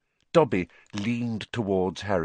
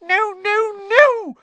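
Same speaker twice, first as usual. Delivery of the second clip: very high, loud squeaks; shrill panicked elf squeak; 9 dB louder, slower than usual. A narrator's reading. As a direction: squeak your refusal. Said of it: "squeaked Dobby".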